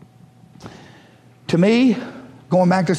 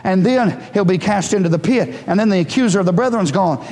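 ordinary talk

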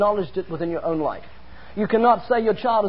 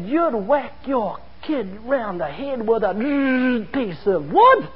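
slow, drawn-out incredulous outrage; berserk announcer; 2 semitones higher, much slower than usual. Retold or imitated. Interviewer aghast at caning talk on live radio, slow mock outrage. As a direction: imitate an appalled interviewer, dragging out the words. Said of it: "Like that. He went berserk".

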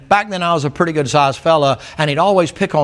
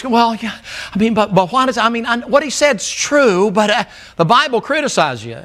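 higher-pitched, stammering mock protest; mock flustered objector; 7 semitones higher than usual. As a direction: lightly mimic a thin-skinned churchgoer. Mimics thin-skinned hearers stammering objections.

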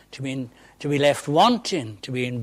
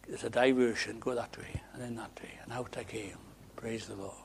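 hushed relief; 3 semitones lower, 11 dB quieter than usual. Real, not acted. Relief, soft.

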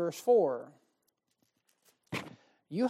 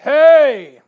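a sudden, much louder, higher stern-dad "Hey!"; 6 semitones higher, 20 dB louder than usual. Retold or imitated. Substitute teacher hits a rowdy sixth-grade class with the dad voice. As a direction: bark it like a father silencing a room. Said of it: "So I thought I'd use my dad voice".

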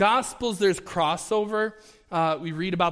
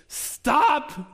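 sharp high loud stop; exasperated shout; 9 semitones higher, 5 dB louder than usual. Retold or imitated. Ahab stopping Micaiah, exasperated shout. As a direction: cut him off, fed up.

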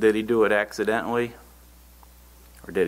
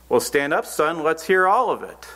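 commanding preacher; 7 semitones higher than usual. Retold or imitated. Evangelist demands again.

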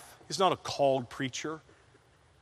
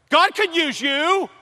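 loud, high, slow preached appeal; emphatic appeal; 13 semitones higher, 9 dB louder, slower than usual. Acted. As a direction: drive each word home slowly.

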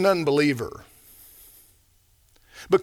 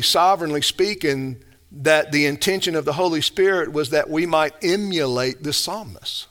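ordinary talk, nothing marked

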